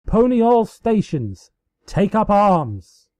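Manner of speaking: high, loud rallying orders; battle cry. A narrator's reading. Celestia calls ponies to arms, shouting high and loud. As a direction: rouse the troops, full voice.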